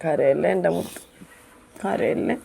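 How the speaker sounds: near-whispered tender comfort, slow and soft; soft soothing hush. Real, not acted. Mother soothing crying child.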